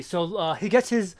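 ordinary delivery

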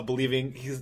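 normal talking voice

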